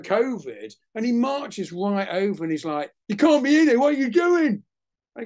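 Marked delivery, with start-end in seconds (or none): 3.1-3.8 high, loud imitation of a furious boss; acted outraged yell; retold angry shout. Massive boss marches over, confronts him. 3.8-4.6 high, very loud mock outrage; acted bellowing demand; retold angry shout. Massive boss marches over, confronts him.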